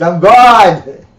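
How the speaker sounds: loud proclamation breaking into laughter; hammed-up divine announcement, half laughing; booming voice of God. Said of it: "the big booming voice comes out".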